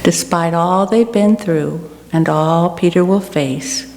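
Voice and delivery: ordinary talk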